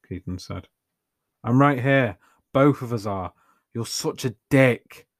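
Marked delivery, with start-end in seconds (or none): 1.4-5.1 angry. A narrator's reading. Anya angry at Keaton.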